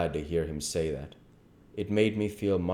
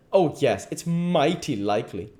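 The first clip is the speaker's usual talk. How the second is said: higher, heavily stressed mock agreement; scornful disbelief; 9 semitones higher, 6 dB louder than usual. King telling the boy to shut up, scornful disbelief. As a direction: pour scorn on the excuse.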